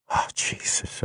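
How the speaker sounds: soft intimate whisper. Acted. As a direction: breathe it out in awe. Lovers' intimate confession.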